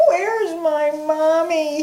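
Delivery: high, slow little badger wail; a lost cub crying for her mother. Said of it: "she wailed".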